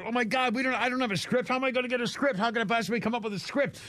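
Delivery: high-pitched panicked rant, imitated; freaking out. Larry David panics to Jerry over a missing script, freaking out. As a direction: spiral into mock panic, voice pitched right up. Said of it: "he's freaking out and he's going crazy".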